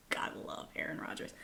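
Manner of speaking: breathy whispered blessing. Real, not acted. Vlogger whispering fond praise of Aaron Rodgers, whisper. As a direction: whisper it like a little prayer.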